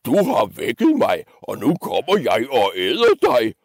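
menacing troll, pitch lurching upward; roaring troll. Acted. Troll threatening to eat the little goat, theatrical roar. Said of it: "brølede trolden".

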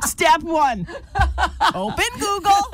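mock-instructive through laughter; teasing the co-host with laughing steps; laughing tease. Real, not acted. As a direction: spell it out like to a beginner, giggling.

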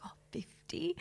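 high, whispered tactful guess; hushed, polite lilt. Retold or imitated. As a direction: whisper it delicately, as if sparing his feelings. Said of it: "Lily politely was like".

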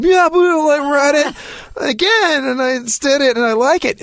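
squeaky, very high mock protest; pleading excuse. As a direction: plead your case in a hammed-up falsetto. Cornered, pleads with her.

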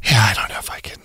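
whispered, choked up. Real, not acted. Emotional host to listeners, whispered. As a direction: barely whisper it through emotion.

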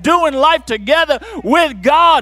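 high, ringing shout building up; preaching shout. Real, not acted. Preacher promising the congregation, building to a shout. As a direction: lift it to a shouted peak.

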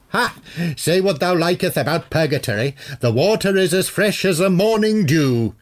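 high, cheery olde-worlde warlock voice; cheerful old warlock. A narrator's reading. Warlock praising toilet water, cheerfully in character. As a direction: boom out the thees and thous happily. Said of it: "he said cheerfully".

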